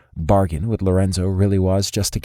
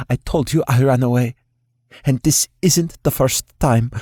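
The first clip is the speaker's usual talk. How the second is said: higher, defiant and indignant; huffy young woman; 5 semitones higher than usual. A narrator's reading. Alina insists to the boss she ran away. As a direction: huff it out, fed up. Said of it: "Alina huffed".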